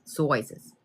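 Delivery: "Sizes" slipped into a passing accent, vowel like "soizes"; accidental accent. Real, not acted. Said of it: "I don't believe I just got that accent".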